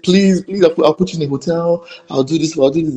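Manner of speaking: beg, then hurry out the promises; pleading. Retold or imitated. Director promising actress a hotel, urgent pleading.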